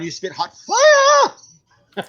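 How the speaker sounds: high, drawn-out hyped-up cry; exaggerated high-pitched hype shout; Giovanni impression. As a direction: stretch it out in a high comic holler. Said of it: "But Giovanni's going".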